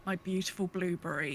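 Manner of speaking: ordinary talk, nothing marked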